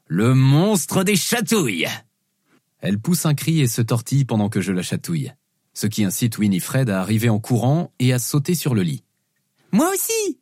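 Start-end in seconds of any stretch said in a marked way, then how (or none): 0.1-1.9 slow, drawn-out mock-monster announcement; playful monster. Dad pouncing on daughter, playful monster voice. 9.7-10.4 put-on very high, squeaky little-girl voice; excited small child. Youngest daughter wanting tickles too, squeaky child voice.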